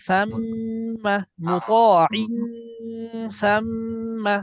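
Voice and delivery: মুতাইম and সাম্মা with long held nasal hums; slow, measured recitation, humming through সাম্মা; recitation with ghunnah. Shown as a demonstration. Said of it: "সর্বদা মনে রাখবেন অনুসার।".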